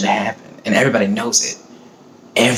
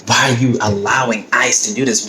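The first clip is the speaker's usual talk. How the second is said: usual speaking voice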